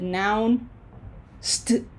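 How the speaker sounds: "noun, st-" with the end cut off after a pause; stopped mid-syllable. Shown as a demonstration. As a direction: split "nounced" wrongly into noun, then a stray st. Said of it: "cannot stop in the middle of a syllable".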